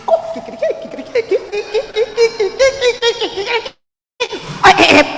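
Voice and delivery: high, loud crowing in bursts; hyper rooster. Acted. Gallo working up to a proposal, hyper rooster crowing. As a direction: crow excitedly at full volume. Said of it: "hyper, lots, and I'm talking about".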